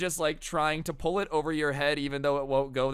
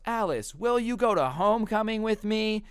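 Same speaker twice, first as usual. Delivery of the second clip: high, theatrical asking-out voice; mock grand proposal; 9 semitones higher, 3 dB louder than usual. [name] asks Alice to homecoming after his dance, high mock-theatrical voice. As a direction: pop the question like a showman.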